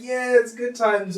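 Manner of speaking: slow, high and loud mock-cool drawl; hammed-up teacher. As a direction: ham it up like a goofy teacher. Teacher clowning in front of class.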